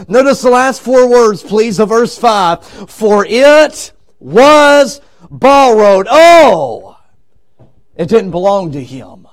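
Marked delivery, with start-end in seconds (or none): 2.9-6.1 slow, weighty reading. Acted. Reads verse with dramatic weight. 6.1-7.0 exclaimed oh. Acted. Dramatic exclamation.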